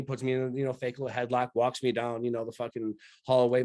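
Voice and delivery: ordinary talk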